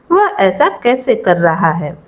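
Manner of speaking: usual speaking voice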